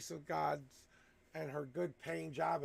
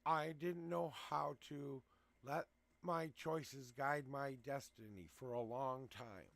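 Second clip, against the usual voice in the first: ordinary talk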